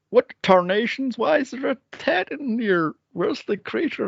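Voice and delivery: high-pitched mock country accent; weird film accent. Farmer Green wandering round the woods, in a weird country accent. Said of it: "what accent is that supposed to be".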